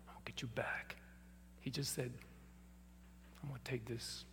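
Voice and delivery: soft unvoiced whisper. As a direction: breathe the words like a secret vow. Quoting the boy's inner resolve, hushed.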